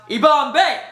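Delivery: mock war shout, not full volume.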